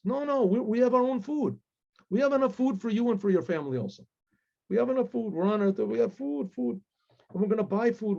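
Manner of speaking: acted, high-pitched generous insisting; eager insistence. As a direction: brush off the worry, pitched well up. Rich guests eagerly insist on sharing food.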